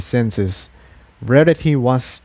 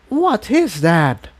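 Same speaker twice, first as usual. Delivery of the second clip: higher, slow wondering question; curious pony voice; 8 semitones higher, slower than usual. Acted. Pink unicorn asks Spike about the fire, curious. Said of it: "she asked".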